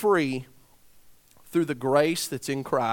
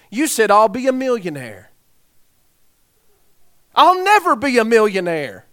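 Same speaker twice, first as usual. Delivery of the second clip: accusing retort; 7 semitones higher, 10 dB louder than usual. Retold or imitated. Heckler accuses the speaker.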